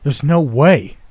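loud, high shout of denial. A narrator's reading. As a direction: shout down what you're hearing. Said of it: "I stood up, shouting".

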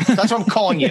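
ordinary talk, nothing marked

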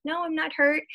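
high-pitched retold denial; retold denial to child. Mother telling her little boy she isn't hurt, retold denial. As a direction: lift the pitch to imitate answering a child.

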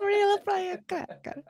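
high, slow mock Italian accent. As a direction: savour it in a silly Italian accent. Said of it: "talk in an Italian accent".

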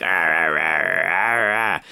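low, wordless groping grunts; mock growl of a blind man reaching out; lightly voiced blind man groping and grunting; blind painter's grunts. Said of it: "like, reaching out for her and, like".

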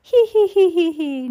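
very high, loud tee-hee, falling away; laughing. A narrator's reading. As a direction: giggle in a put-on squeaky voice. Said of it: "Nero laughed".

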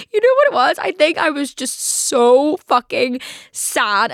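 excited. Real, not acted. Sudden realisation, burst out.

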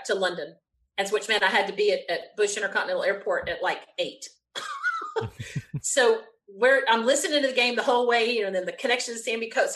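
ordinary talk, nothing marked

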